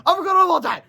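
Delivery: high, loud alarmed cry; cried out. A narrator's reading. Harold remembering the test, high loud cry. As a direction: yelp it in alarm. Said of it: "Harold cried".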